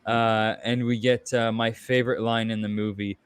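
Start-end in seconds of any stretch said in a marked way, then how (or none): none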